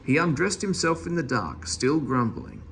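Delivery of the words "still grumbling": ordinary delivery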